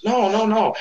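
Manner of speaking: mock high-pitched protest. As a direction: brush it off in a high quick voice. Boss waving off complaint.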